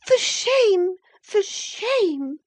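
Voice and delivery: very high, indignant cry; angry reproach. A narrator's reading. Isabella rebukes her sister-in-law, angry high reproach. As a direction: cry it out in outrage, twice. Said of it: "she repeated angrily".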